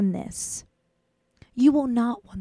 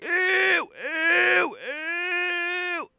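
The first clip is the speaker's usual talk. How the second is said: higher, whiny cringing ews; disgusted ew; 8 semitones higher, 5 dB quieter, much slower than usual. Retold or imitated. Back at the dorm, regretting the kiss, a whiny disgusted cry. Disgusted after kissing the boy.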